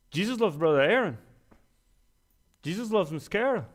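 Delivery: ordinary talk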